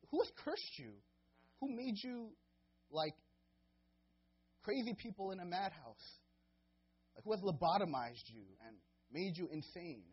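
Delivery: high, loud, impassioned pulpit rebuke; fiery preaching. Acted. Pastor voicing Paul's outrage at the Galatians, fiery preaching. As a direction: preach it hard, pleading and scolding.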